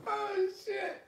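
gasped high, still laughing; breathless after laughing. Real, not acted. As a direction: catch your breath mid-laugh.

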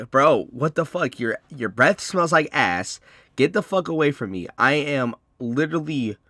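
loud, high-pitched retold telling-off; loud, indignant comeback; exasperated outburst. Retold quarrel, telling her off.

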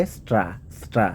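স্ট্রা said as "stra", one blended syllable, twice; pronunciation demo. Shown as a demonstration. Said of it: "ট্রা হবে এটা". (স্ট্রা যুক্তভাবে দুবার উচ্চারণ)

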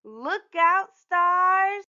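high, loud call to the sky; big drawn-out excited cry; excited shout. A narrator's reading. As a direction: holler it out with glee. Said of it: "shouted Usha".

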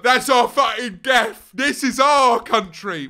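hammed-up patriotic shout in the car; mock defiant rally cry. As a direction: boom it out like a proud battle cry. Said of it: "We're going like".

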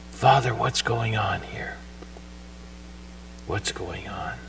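whispered, slow, private plea to the Father; hushed prayer. Acted. Jesus alone on the hill whispering to his Father. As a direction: breathe it out like praying alone in the night.

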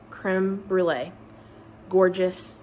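ordinary talk

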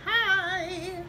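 high, loud bright hello; excited greeting. Real, not acted. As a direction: burst in with a loud cheerful hi. Vlog greeting to camera.

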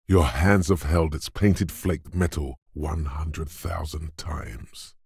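ordinary delivery

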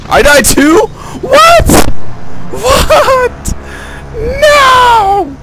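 high, loud disbelieving yell; shocked shout. Real, not acted. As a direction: scream it like you can't believe you died. Game character dies.

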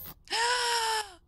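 sharp startled gasp. Real, not acted. Startled reaction to game scare.

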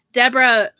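usual speaking voice

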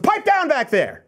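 high, slow comic scolding voice; a high, drawn-out "pipe down" from the front seat; Phil Hartman impression. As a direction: bark it like a put-upon movie dad. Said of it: "Like Phil Hartman in Turbo Man".

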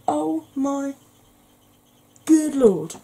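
high, rising gasp of awe; awed exclamation. Real, not acted. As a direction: marvel at it out loud. Awed by shimmer of eyeshadow.

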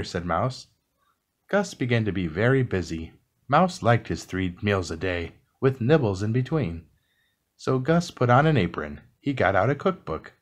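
ordinary delivery

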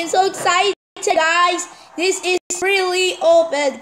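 high-pitched thrilled announcement; excited. Real, not acted.

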